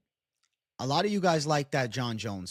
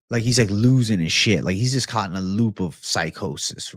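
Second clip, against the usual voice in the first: ordinary talk, nothing marked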